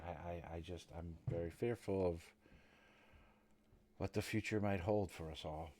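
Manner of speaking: soft, halting worry that fades away; understated anxious servant, subdued and faltering; fearful servant. Acted. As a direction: voice the worry quietly, with hesitant pauses. Game master plays the fearful servant.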